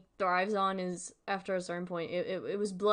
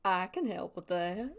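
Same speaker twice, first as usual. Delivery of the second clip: put-on Southern accent. The TV just comes in offering help, playful Southern accent. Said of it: "no more Southern accent".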